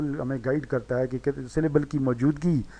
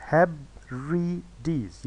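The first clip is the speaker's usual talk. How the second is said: हेब्रिडीज broken slowly into three separate syllables; slow, split-up हेब्रिडीज, each syllable on its own; much slower than usual. Shown as a demonstration. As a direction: sound out हेब्रिडीज with a gap after each syllable. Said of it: "ये देखें यहाँ पे किया गया है".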